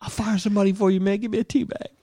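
high, pushy pleading addict; begging addict. Pretend. Boss begging his coworker for a tea bag. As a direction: whine it fast and desperate.

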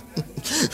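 short high bitter laugh; a quick rueful laugh. Acted. Man laughs bitterly to his old friend, short and high.

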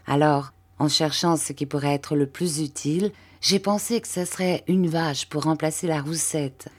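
plain reading voice

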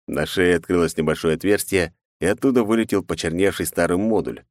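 usual speaking voice

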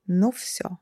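hushed whisper. Acted. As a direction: breathe it under your breath. Dread after friend falls out.

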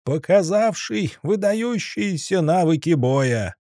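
high, drawn-out arena announcer bellow; booming ring announcer. Acted. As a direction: call it out to the whole arena. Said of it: "раздухарился Заяц".

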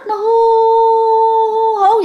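long ghostly "hú"; imitated howling wind. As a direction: howl like wind in a horror film. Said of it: "giống như là trong phim ma".

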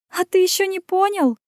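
high giggly tease; giggling. Acted. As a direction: giggle through the question. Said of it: "хихикнула Ирен".

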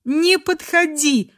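almost hysterical, slowly stretched warning; near-hysterical cry. A narrator's reading. Crying girl keeps the man away, near-hysterical cry. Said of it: "почти истерическим голосом закричала".

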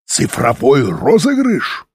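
slow, scornful outburst; angry exclamation. A narrator's reading. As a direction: spit out the words in disbelief. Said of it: "воскликнул Фаш". (возмущённый возглас)

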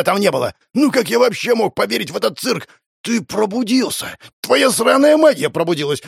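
high, frantic ranting; hysterical accusation. A narrator's reading. Frantic man accusing the hero of theft, hysterical. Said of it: "Его сейчас истерика хватит".

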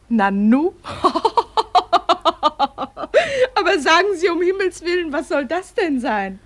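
bursting into loud laughter; amused laughter, high and loud; laughing out loud. Acted. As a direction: laugh at the odd sight and talk through it. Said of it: "Ja, Sie lachen".

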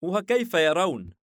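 lightly indulgent curiosity; smiling, playing along. A narrator's reading. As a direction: humour him with a smile in the voice. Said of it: "ليفياثان مجاريا إيزيس باسما".

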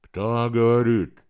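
muffled voice through a mask; firefighter air mask. Acted. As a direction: speak strained behind a mask. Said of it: "сдавленный голос, словно его обладатель говорил сквозь маску".

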